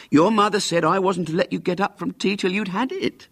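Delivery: insistent nurse. Acted. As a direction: nurse insisting with the mother's authority.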